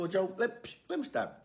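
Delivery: high, startled interruption; cutting him off. Retold or imitated. Narrator cuts Joe off.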